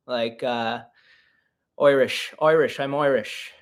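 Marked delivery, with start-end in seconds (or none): 1.8-3.5 repeated tries at an Irish accent. Shown as a demonstration. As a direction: find your way into an Irish brogue. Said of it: "Maybe an Irish one".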